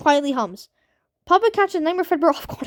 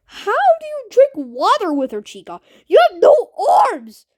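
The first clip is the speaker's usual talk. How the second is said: incredulous outcry; 5 semitones higher, 4 dB louder than usual. Real, not acted. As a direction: shout at the absurdity. Mocking absurd game event.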